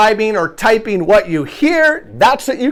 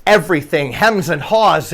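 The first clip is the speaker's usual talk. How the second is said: normal talking voice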